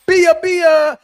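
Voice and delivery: loud, high cry of laughter; laughing shout. Real, not acted. Cracking up at a viewer's super chat, loud laughing shout.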